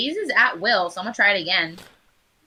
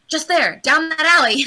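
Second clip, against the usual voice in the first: telepathic misdirection; 8 semitones higher, 6 dB louder than usual. Pretend.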